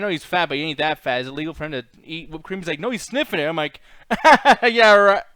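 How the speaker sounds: ordinary talk, nothing marked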